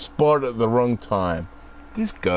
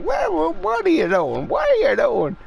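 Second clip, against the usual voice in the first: high, sing-song imitation of mum chatting to the magpie; mum talking to a bird. Imitating mum greeting the magpie in a high, sing-song voice. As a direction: coo at the magpie like mum does. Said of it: "my mum talks to the magpie and it goes".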